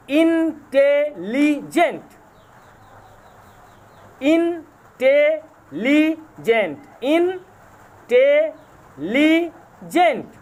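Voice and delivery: intelligent said very slowly, syllable by syllable; teacher slowly sounding out intelligent for the class; slow syllable demo. Shown as a demonstration. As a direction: let each beat of intelligent stand apart. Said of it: "four syllables".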